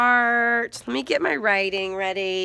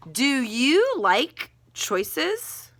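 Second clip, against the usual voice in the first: slow question tune; 4 semitones higher, much slower than usual. Shown as a demonstration. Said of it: "I'm gonna ask that as a question".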